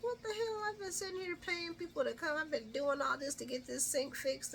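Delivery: high-pitched incredulous griping; mock exasperation. Recapper voicing Carly after paying people for the sink, mock exasperation.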